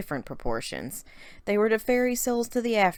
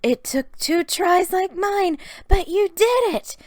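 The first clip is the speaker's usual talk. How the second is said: much higher and louder, slow, bubbling; Sarah hopping with joy for her sister; excited; 14 semitones higher, 6 dB louder than usual. A narrator's reading. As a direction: bounce with excitement as you say it. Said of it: "Sarah hopped up and down excitedly".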